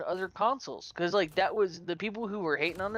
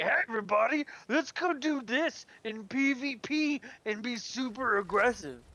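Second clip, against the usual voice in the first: over-eager brother; 6 semitones higher than usual. Retold or imitated. Friend's brother hopping into the group, over-eager.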